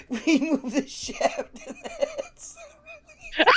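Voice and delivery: high, breaking into helpless laughter; repeating a friend's line while cracking up; laughing. Real, not acted. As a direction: barely get it out through laughing. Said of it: "I can't take anything you're saying seriously".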